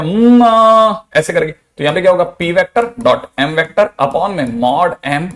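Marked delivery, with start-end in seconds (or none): 0.0-1.1 mock yell, slow and much louder; loud drawn-out mmaa. Shown as a demonstration. Teacher voicing vector M crying out, loud stretched yell. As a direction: stretch out a high, loud mmaa like a cry of pain. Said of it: "तो M तीन बार चलाएगा".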